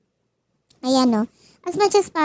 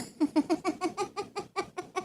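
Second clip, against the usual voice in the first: soft laugh; 5 semitones higher, 14 dB quieter than usual. Real, not acted.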